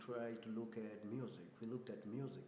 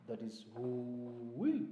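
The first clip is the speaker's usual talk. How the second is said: hyena call; 4 dB louder, much slower than usual. Shown as a demonstration. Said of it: "That's the sound of a hyena".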